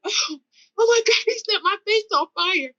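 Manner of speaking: high-pitched reenacted cry of alarm; panicked hollering. Driver believing her face was set on fire, hollering. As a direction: wail it in retold horror. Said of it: "but I'm screaming and hollering".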